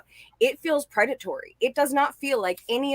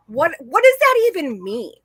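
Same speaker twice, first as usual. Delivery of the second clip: normal talking voice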